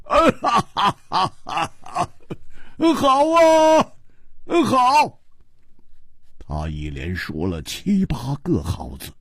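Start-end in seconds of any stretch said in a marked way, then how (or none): 0.0-5.2 big acted belly laugh, then drawn-out words of approval; loud laughing. A narrator's reading. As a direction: laugh heartily and call out long, high approval. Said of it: "大笑着说".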